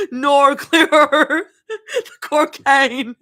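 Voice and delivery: hammed-up Australian, loud and drawn out; slow, high Australian accent through laughter; exaggerated Aussie vowels, laughing; bad Australian accent. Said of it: "Bad Australian accent".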